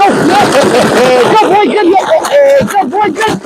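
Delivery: very high-pitched cheering; high, playful hype for a dog; excited dog praise. Real, not acted. Trainer praising dog in play.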